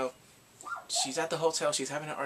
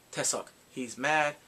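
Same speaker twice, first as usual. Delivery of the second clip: ordinary delivery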